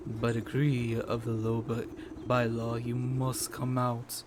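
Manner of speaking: low, stern land baron; stern tycoon voice. Acted.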